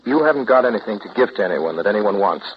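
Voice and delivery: ordinary delivery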